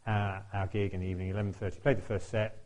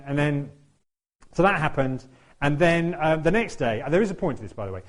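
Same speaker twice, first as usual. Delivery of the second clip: usual speaking voice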